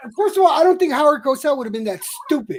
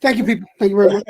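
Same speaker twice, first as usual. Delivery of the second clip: Stossel impression; 6 semitones lower, much faster than usual. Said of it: "best Stossel impression".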